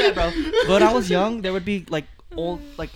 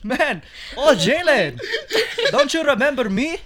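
put-on lilting Filipino-accented auntie, pitched up; 6 semitones higher, 5 dB louder than usual. Auntie greeting a boy she once knew, Filipino accent. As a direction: play an excited Filipino auntie, higher and louder. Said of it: "Filipino ladies that would come up to me".

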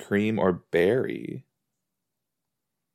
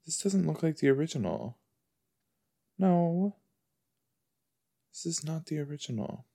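quiet dismay; 5 semitones higher, 8 dB quieter than usual. Real, not acted.

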